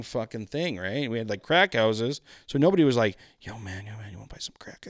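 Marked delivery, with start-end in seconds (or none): 3.4-4.9 hushed, whispered street pitch; whispered imitation of a dealer; furtive offer. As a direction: offer it secretly under your breath.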